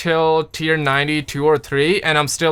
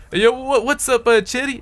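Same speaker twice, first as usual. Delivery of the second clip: put-on a poor try at a Scottish accent, pitched higher; bungled Scottish accent; failed Scottish accent; 9 semitones higher than usual. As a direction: greet a friend in a bad Scottish accent. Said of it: "I cannot do that Scottish accent".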